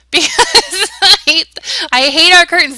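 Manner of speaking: loud, high exasperated outburst; frustrated rant. Real, not acted. Frustrated builder venting to viewers about curtains, loud exasperated outburst. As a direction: blurt it out, fed up with the curtains.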